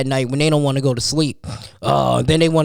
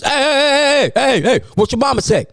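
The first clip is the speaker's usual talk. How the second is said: stepdaddy voice; 7 semitones higher than usual. Retold or imitated. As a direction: yell like a strict stepdad getting the kids in line. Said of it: "That's my best stepdaddy voice".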